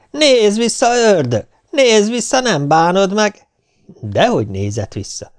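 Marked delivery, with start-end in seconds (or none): 0.1-3.5 very high, loud teasing call; mocking shout. A narrator's reading. Poor man mockingly calls after the fleeing devil, high and loud. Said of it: "eleget kiabálta".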